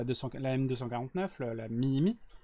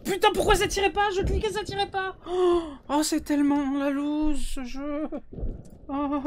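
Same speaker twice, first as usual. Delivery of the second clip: frustrated whine; 13 semitones higher, 5 dB louder, slower than usual. Real, not acted. Frustrated gun won't fire.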